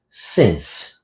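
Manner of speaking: "Since" short and low for the class to repeat; model to repeat. Shown as a demonstration. Teacher modelling since for students to repeat after her. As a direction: give "Since" short and soft to echo back. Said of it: "Repete comigo".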